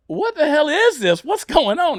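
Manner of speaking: high, slow, baffled cry; astonished question. Retold or imitated. Comic asking the girl at the bar, baffled and high. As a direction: act floored by what you see.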